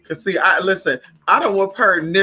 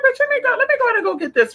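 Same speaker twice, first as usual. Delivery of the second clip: high squeaky voice for her own thought; mock inner voice; faster than usual. Telling viewers about the Dollar Tree trip, high mock voice. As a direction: pitch way up, playing her younger self. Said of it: "So I said".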